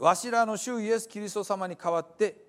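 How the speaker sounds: measured reading of the letter in Hiroshima dialect; scripture reading. Acted. Pastor reading Paul's letter to the church, measured reading. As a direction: read it out like the apostle's greeting.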